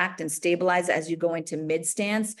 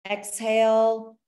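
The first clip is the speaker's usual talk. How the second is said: slow, louder exhale cue stretched out; drawn-out exhale cue; 2 semitones higher, 4 dB louder, slower than usual. Shown as a demonstration. Coach cueing class, slow stretched exhale. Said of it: "every time you lean forward".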